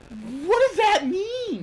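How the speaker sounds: high, slow, puzzled mock question; mocking confused voice. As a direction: drag it out in high disbelief. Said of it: "all social media is like".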